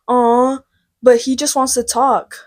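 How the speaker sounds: lightly voiced friend, sticking up for him; imitating friend A. Friend speaking up for her chatty boyfriend, imitated pleading. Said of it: "friend A goes".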